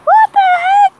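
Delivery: shocked high shriek; real disbelief, yelled high; surprised. Real, not acted. Impossible distance, ball missed.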